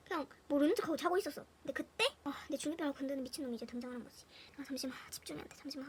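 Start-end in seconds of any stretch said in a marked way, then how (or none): 4.6-5.9 soft whisper, pretending to be asleep; sleepy whispered brush-off. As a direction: mumble it softly, eyes shut. Pretending to sleep, self quote.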